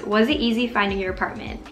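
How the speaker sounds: ordinary talk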